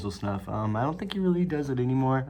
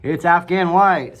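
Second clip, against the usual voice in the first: louder, higher, slow; loud clear correction; correct name said loud; 7 semitones higher, 11 dB louder, slower than usual. Shown as a demonstration. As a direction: announce the right name firmly. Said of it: "that's not what it's called".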